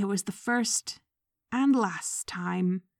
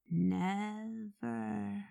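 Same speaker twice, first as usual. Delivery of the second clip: Nev-er drawn out slowly, syllable by syllable, much softer; 8 dB quieter than usual. Acted. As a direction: say Never slowly, syllable by syllable, almost to himself. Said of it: "pronouncing the word slowly, syllable by syllable".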